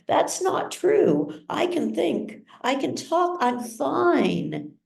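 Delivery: desperate protest. A narrator's reading. Patient pleads with doctors, high and pleading. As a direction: beg them to believe him.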